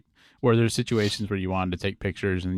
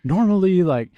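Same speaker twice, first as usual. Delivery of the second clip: higher, louder mock-rambling friend; a lightly voiced, higher imitation of the rambling friend; mimicking the friend; 5 semitones higher, 4 dB louder than usual. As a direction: parody his over-explaining texts. Mocking friend's rambling camera texts.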